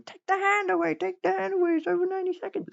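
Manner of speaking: very high mock-panic squeal; hammed-up high-pitched panic; imitated freaking out. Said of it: "everyone else starts freaking out".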